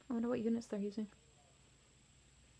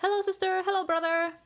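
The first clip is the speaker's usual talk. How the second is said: higher, louder happy hellos; eager greetings; 9 semitones higher, 7 dB louder than usual. Acted. Little rex greeting his sister and brother, higher and louder. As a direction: call out brightly to them.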